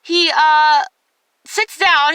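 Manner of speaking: ordinary talk, nothing marked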